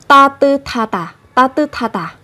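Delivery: "ta tư tha tà" with the t carried over as breathy th; linked aspirated th. Shown as a demonstration. Teacher shows how to pronounce it, linked "ta tư tha tà". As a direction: run "tư tha" together, puff of air on "tha". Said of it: "Các bạn sẽ phát âm như sau".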